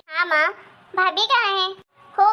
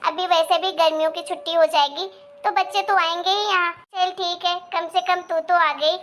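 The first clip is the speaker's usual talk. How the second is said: ordinary delivery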